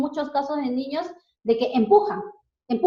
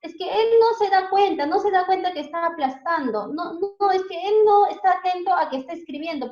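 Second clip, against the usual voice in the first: high, insistent parent protesting; mock parent defending the child, pitched up; imitating a worried parent; 7 semitones higher, 4 dB louder than usual. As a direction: parents insisting the child didn't notice, higher and louder. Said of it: "los papás dicen".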